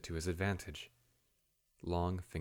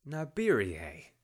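sharp interjection; 6 semitones higher, 6 dB louder, slower than usual. A narrator's reading. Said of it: "Hjalmar interjected".

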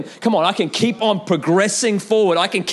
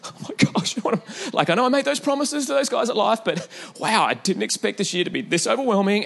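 laughing aside; 4 semitones higher than usual. Real, not acted. Laughing aside about overwhelming year.

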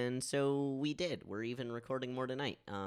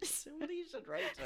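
laughing; 6 dB quieter, faster than usual. Real, not acted. Laughing at absurd story.

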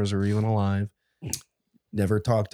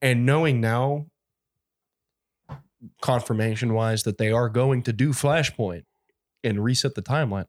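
ordinary talk

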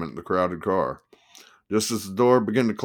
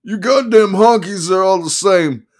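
high, loud yelling reads on; 10 semitones higher, 8 dB louder than usual. Acted. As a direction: keep the attorney's yell going by mistake. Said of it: "he yelled".

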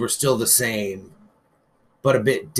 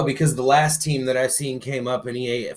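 normal talking voice

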